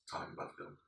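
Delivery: whispering. Acted. Joking about not getting sued.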